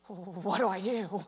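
slow, wavering, put-on helpless voice; drawn-out mock quaver; mock shaking youngster. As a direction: imitate someone trembling with the question. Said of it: "And they're shaking".

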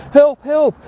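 high, loud retold cry for help; retold scream. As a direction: mimic a child screaming for help. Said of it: "She's screaming".

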